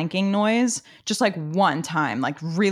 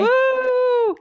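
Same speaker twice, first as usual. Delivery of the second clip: high, loud happy whoop; excited whoop; 6 dB louder than usual. Real, not acted. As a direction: cheer while dancing. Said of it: "I was dancing".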